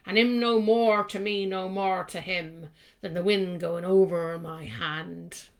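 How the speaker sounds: slow, loud Cornish poetry reading; Cornish accent. Shown as a demonstration. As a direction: recite it in a broad, loud Cornish burr. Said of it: "read that in a kind of Cornish accent".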